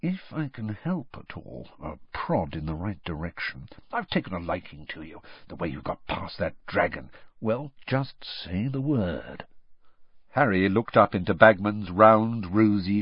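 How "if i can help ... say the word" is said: soft, secretive offer; almost whisper the offer; very quiet. A narrator's reading. Bagman secretly offering Harry help, very quiet. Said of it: "his voice was so quiet now".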